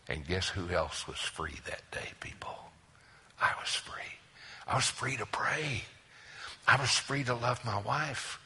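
breathy hushed whisper. Real, not acted. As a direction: lean in and whisper the secret. Hushed revelation to congregation.

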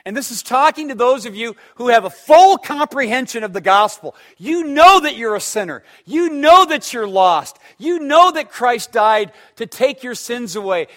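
high, loud pulpit preaching; fiery sermon delivery, pressing each "know"; full-force preaching. Acted. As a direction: hammer each line home from the pulpit.

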